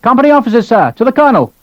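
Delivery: calling out. Acted. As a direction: call up to him from a distance. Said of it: "A sergeant was calling him".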